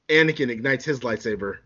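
ordinary delivery

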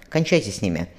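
ordinary delivery, nothing marked

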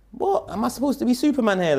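mock outrage, as if nobody else will help; incredulous disbelief. Retelling his shock at the older brother just watching, high incredulous.

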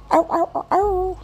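very high little dog yips; imitated dog yelps. Fido answers his owner about the bone, high yelps. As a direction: yelp like a pleading dog. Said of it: "This is what Fido's saying in his brain".

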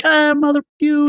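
mock road-rage New Yorker; angry New Yorker. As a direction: curse out the pedestrian, hammed up. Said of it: "February first, they're like".

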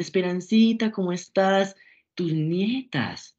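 high, drawn-out voice of a fussy relative greeting grandma; gushing relative. Retold or imitated. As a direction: play the sugary aunt cooing hello. Familiares saludan a la abuela. (parienta empalagosa saludando a la abuela, voz aguda y alargada)

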